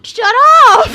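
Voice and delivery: high, loud squeal of protest; laughing shout. Real, not acted. Woman teased about her spelling, high laughing shout. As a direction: yell it through real laughter.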